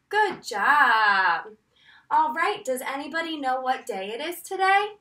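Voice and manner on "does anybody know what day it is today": ordinary delivery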